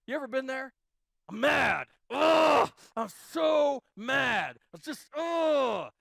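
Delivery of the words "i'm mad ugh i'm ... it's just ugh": loud, slow, groaning frustration; acted anger. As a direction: act out a man fed up and shouting.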